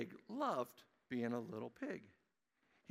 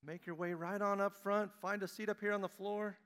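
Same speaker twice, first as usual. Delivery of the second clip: calling out; 8 semitones higher, 5 dB louder than usual. Real, not acted. Pastor calling children forward, raised higher call.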